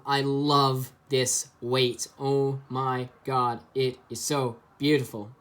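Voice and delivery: slow, emphatic adoration; real, drawn-out fan enthusiasm; gushing admiration. Real, not acted. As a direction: savour every word in awe. Gushing over a favourite part.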